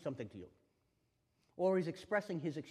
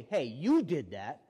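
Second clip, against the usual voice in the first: accusing; 10 dB louder, slower than usual. Shown as a demonstration. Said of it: "You don't wanna say".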